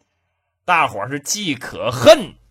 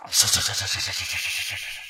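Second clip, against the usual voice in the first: 喘气跑步声; 11 semitones lower, 7 dB quieter, much faster than usual. Acted. 跑步喘气声.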